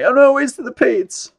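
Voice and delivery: high, put-on indignant reply; mock protest. Answering the viewers in a high, mock-indignant voice. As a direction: playfully defend herself. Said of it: "I'm like".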